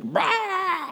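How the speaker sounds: high, drawn-out "rah" noise; imitated wild toddler. Father imitating his three-year-old all over the house, wild yell.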